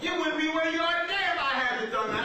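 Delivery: hammed-up dad, loud and high; shouting defiant father. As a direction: holler it like an unrepentant father. Father boasting of old punishment.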